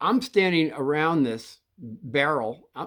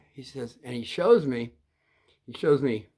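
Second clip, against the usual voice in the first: usual speaking voice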